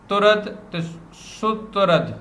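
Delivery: ترد slowly and clearly spelled out, then سترد with u after the s; careful demo pronunciation. Shown as a demonstration. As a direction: slowly sound out سترد as sutrad. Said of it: "سین کے اوپر پیش عبارت پڑھنی ہے".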